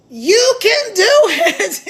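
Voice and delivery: loud, slow, laughing encouragement; encouraging. Real, not acted. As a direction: cheer them on, slowly. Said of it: "The only thing stopping you".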